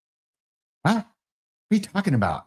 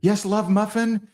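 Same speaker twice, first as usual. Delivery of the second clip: lightly voiced wife; 8 semitones higher, slower than usual. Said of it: "she's gonna say".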